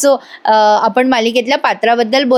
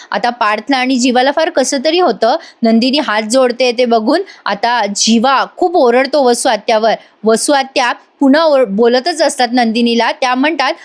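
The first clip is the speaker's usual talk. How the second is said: usual speaking voice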